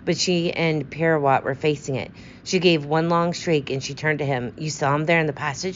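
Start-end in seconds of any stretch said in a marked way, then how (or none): none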